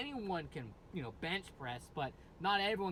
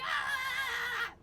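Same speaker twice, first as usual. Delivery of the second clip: put-on high shrieking old lady cry; 5 dB louder than usual. Shown as a demonstration. Vocal coach demoing the old lady scream shriek. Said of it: "Think of an old lady going, you know, talking very fast and loud".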